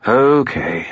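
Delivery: high resigned spirit voice; Bob skull voice. A narrator's reading. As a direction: give in with a sigh. Bob gives in.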